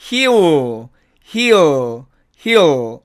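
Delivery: "he'll" as "hi-o", L left open; contracted he'll. Shown as a demonstration. Teacher modelling he will shortened, contracted. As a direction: glide "he'll" into "hi-u", tongue never touching. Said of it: "이렇게 발음하고요".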